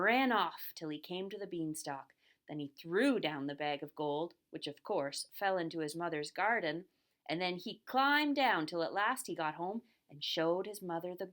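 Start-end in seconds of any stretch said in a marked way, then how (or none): none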